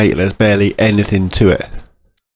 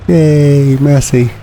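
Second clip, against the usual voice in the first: excited cheer; 6 semitones higher, 3 dB louder, slower than usual. Real, not acted. Cheers on finding the Massey.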